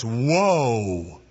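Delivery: long drawn-out, low falling whoa; horse command. Acted. Rider telling his horse to stop, long falling call. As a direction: rein in a horse with one stretched word. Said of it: "you tell the horse".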